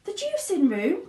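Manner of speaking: very high, alarmed question; horrified cry; cried. Acted. Mother cries out at the juicing room. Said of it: "cried Missus Beauregard".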